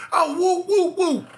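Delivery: high yelping woos; pained yelps. Retold or imitated. Boy yelping over his stung hand, high yelps. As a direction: yelp from the sting. Reacting to the pain.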